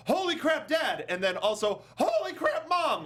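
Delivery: very high, excited squeals; hammed-up delighted shock; mock shocked child. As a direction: squeal with joy at parents. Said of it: "Molly's like".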